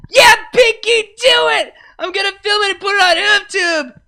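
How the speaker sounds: put-on very high, loud drunken shout; screaming cheer. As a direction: whoop her on like a rowdy drunk. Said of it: "she screamed".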